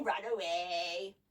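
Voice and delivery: slow, low, drawn-out echo; giant's fading command. A narrator's reading. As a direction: let it sink and die away slowly. Giant's echoing second command.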